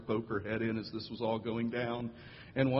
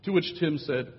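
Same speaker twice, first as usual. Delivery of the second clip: ordinary delivery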